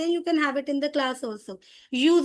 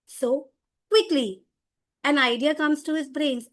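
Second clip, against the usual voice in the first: ordinary talk, nothing marked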